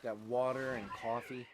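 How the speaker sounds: slow New Jersey drawl on "water" and "coffee"; New Jersey awe sounds. Shown as a demonstration. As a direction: lean into the New Jersey aw vowels. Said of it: "A lot of awe sounds".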